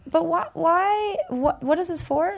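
high, slow, drawn-out confused whine; baffled questioning. Pretend. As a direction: play it puzzled and incredulous. Imagines questioning the experimenter.

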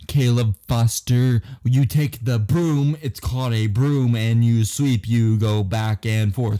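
slow, forceful mock orders; condescending boss. Boss explaining sweeping to a new hire, condescending. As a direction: spell it out slowly and loudly as if to someone dim.

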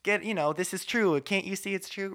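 a hammed-up arrogant believer talking down; smug know-it-all. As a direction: lecture them loudly and smugly. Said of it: "walk in when you know the truth and say".

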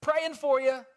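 mock shout. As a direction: yell it offhandedly across the water. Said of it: "he doesn't just yell out".

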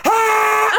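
high loud scream; high shout. Shown as a demonstration. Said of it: "As loud as I could".

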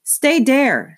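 put-on high, loud little-girl command; a toddler pointing and ordering the roaches to stay. A narrator's reading. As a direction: boss them like a tiny princess. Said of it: "said Boots, pointing".

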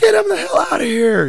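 high, loud retold order; mock outraged shout. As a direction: shout for security, hammed up. Imagined star having him thrown out.

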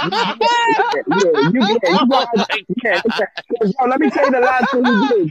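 laughing through his words; can't stop laughing while talking. Real, not acted. Telling the host a funny story through laughter.

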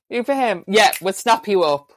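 hammed-up chief, haggling eagerly. To the Englishmen, a hammed-up chief snapping up the trade. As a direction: play the chief grabbing the deal. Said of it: "the Mohawk would go".

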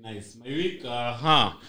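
normal talking voice

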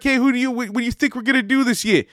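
high-pitched mock New York accent; New Yorker impression. Stranger quizzing him in a parking lot, New York accent. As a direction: imitate a pushy New Yorker questioning you. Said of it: "I don't know why he's a New Yorker".

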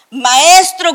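loud, high desperate cry of Maestro; shouted call. Acted. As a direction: yell for the teacher in panic.

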